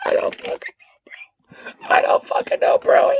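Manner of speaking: high, faint squeak, barely any voice; helpless with laughter. Real, not acted. Streamer worn out by own joke, faint helpless squeak.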